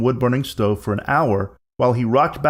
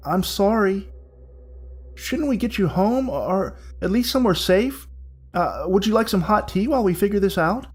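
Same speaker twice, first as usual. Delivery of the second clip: gentle worried helper; 7 semitones higher than usual. Acted. Willie comforting the bride.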